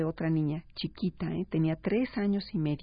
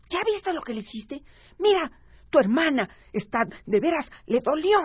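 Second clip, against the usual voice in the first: put-on higher, louder, sharp scolding of a child; angry scolding; 8 semitones higher, 5 dB louder than usual. Shown as a demonstration. Mother angrily scolding her child for hurting his sister. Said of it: "se lo dices al niño enojada".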